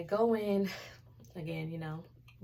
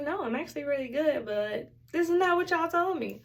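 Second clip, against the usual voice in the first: high, raised-voice objection; insistent retold complaint, pitched up; retold patient protest; 8 semitones higher, 5 dB louder than usual. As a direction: push back with mock alarm. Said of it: "It's like".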